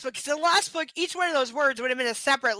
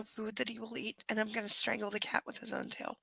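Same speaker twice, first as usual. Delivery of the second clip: a whispered mock threat; 5 semitones lower, 13 dB quieter than usual. Real, not acted. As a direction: breathe the threat out under your voice. Muttered threat at the cat.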